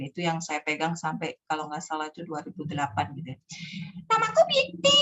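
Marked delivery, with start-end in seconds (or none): 4.1-5.0 put-on very high little-child character voice; Binti. Shown as a demonstration. Storyteller's small character introduces herself, squeaky child voice. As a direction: introduce yourself as a tiny child. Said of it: "karakter suara kecil".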